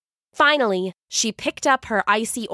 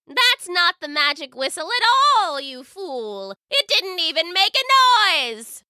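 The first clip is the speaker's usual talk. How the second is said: mocking witch; 9 semitones higher than usual. Witch mocking after laughing.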